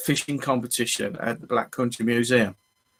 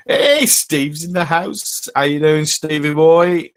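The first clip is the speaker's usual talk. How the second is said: excited greeting; 4 semitones higher, 3 dB louder, slower than usual. Real, not acted. Greeting viewer joining live stream.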